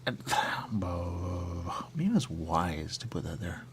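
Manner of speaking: slow unvoiced whisper after a sigh. Real, not acted.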